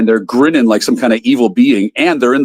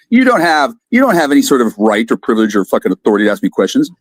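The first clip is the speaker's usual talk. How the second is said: ordinary talk, nothing marked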